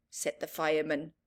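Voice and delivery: ordinary delivery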